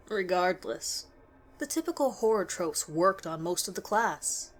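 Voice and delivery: ordinary delivery, nothing marked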